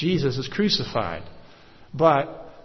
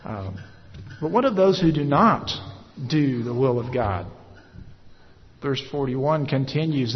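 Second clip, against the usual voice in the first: normal talking voice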